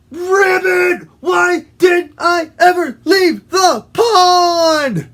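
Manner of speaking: high, loud, drawn-out cry; hammed-up terrified frog; panicked wail. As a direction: wail as you flee for your life. Frog flees fox in panic.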